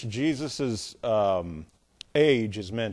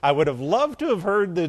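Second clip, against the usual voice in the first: usual speaking voice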